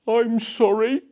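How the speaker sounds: mildly tearful sorry from the book thief; sniffling apology. Acted. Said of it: "he sniffled".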